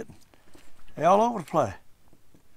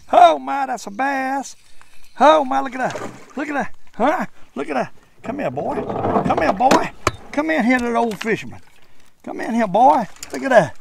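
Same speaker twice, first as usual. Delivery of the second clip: excited; 8 semitones higher, 4 dB louder than usual. Real, not acted. Landing a surprise bass.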